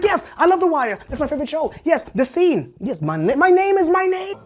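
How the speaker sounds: high, loud gushing; thrilled high-pitched outburst; excited fan. Retold or imitated. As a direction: gush about a favourite show, building to a shout. Acts out his excited fan reply.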